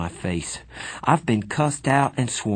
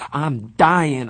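panicked; 6 semitones higher, 5 dB louder than usual. A narrator's reading. Drowning in the fountain.

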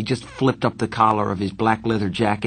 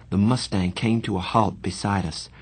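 usual speaking voice

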